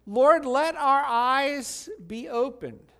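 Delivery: high, loud and slow plea; pleading cry. Acted. Blind men beg Jesus for sight, loud and slow.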